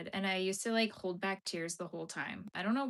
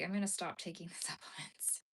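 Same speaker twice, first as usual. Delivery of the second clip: whispered with a laugh; half-laughed whispered thought; mock whisper; 3 semitones lower, 7 dB quieter than usual. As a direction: breathe it like a secret, giggling. Retelling her own thought.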